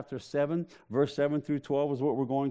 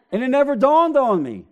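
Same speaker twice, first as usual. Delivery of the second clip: much higher and louder, half laughing disbelief; a loud, high comic outburst; exclaiming; 9 semitones higher, 6 dB louder than usual. Real, not acted. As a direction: shout it out as a joke on yourself.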